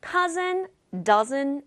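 ordinary talk, nothing marked

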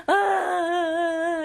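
a short high put-on crying wail; mock sob. Shown as a demonstration. Teacher demonstrates a sob to her class with a mock wail. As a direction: fake a quick sob to show the sound. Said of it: "이런 소리가 나는 게 sob입니다".

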